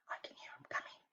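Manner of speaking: hushed, secretive whisper; suspense whisper. A narrator's reading. Teacher whispering suspense to listening children. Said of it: "suspense whisper to children".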